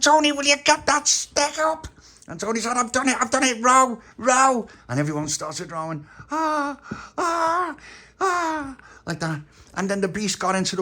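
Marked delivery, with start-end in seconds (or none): 0.0-1.9 high and loud urgent plea; little Bobby urging Tony, high and loud; high child voice. Acted. 2.8-4.7 high, loud rallying call; rally the crew to row; Tony shouting at everyone to row; excited shout. Acted. Tony urging rowing. 6.3-8.8 high straining cries. Acted. Rowers straining, voiced.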